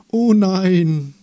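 high, loud wail; drawn-out cry of dismay; dismayed chorus. A narrator's reading. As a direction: wail it in mock horror. Trees' alarmed chorus.

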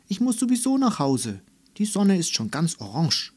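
put-on little girl cheerfully heading home; cheerful chatter. A narrator's reading. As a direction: chirp it happily. Cheerful Melissa leaving.

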